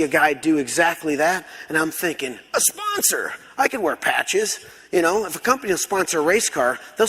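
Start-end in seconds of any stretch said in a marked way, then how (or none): 2.5-4.6 high-pitched eureka cry; acted-out thrilled discovery; sudden excited realization. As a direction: blurt a brainwave, pitch leaping then settling. Acting out his sudden idea.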